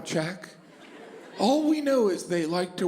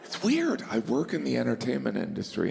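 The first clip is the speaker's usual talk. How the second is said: usual speaking voice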